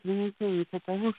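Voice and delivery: normal talking voice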